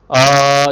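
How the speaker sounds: अ held long and loud as a clean open vowel; sustained vowel. Shown as a demonstration. Teacher to class, demonstrating a long held अ. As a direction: sing out अ steadily for the class to copy. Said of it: "अ का उच्चारण करना शुरू करो".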